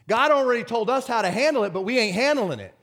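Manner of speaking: high, loud, exhorting preacher; forceful preaching. Real, not acted. Preacher chiding the church for not handling it, forceful preaching. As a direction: drive the rebuke home to the congregation.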